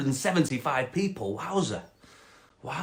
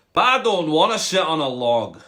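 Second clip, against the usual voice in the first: loud Scottish frog protest; 2 semitones higher, 5 dB louder than usual. Acted. Frog refusing the cat's order, loud Scottish accent. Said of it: "a Scottish frog".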